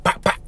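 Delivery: whispered imitation of hitting with the reins; mock slap sound. Shown as a demonstration. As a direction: tap it out under the breath, pak pak. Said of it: "like that, okay?".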